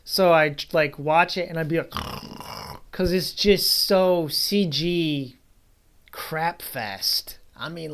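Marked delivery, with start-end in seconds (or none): 1.9-2.9 mock snore of dozing off; imitated snore. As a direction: make a rough snoring noise as if nodding off. Imitates himself dozing off watching.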